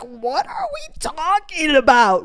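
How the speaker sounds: high, drawn-out incredulous question; hammed-up "are you serious" wail; retold disbelief. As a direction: act out exasperated disbelief, slowly. Her retold disbelief at his alarm.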